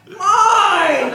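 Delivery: very high, loud acted scream; shrieking outrage. Principal to a screaming teacher, high shrieked outrage. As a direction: shriek it in mock disbelief, building in volume.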